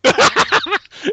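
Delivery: shrieking laugh, very high; high burst of laughter. Real, not acted. Friend says the name sounds like vomit, shrieking laugh. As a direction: crack up loudly.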